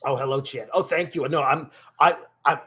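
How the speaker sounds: a rough, throaty persona voice held all through; throaty character voice. Pretend. Streamer greeting chat and commenting in his throaty character voice. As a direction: stay in the throaty character while chatting. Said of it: "it's a very throaty voice".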